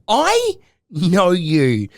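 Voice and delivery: very slow, drawn-out words; slow-motion imitation of a fan; dramatic recognition. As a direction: stretch it out as you recognise someone. Imitates woman recognising her.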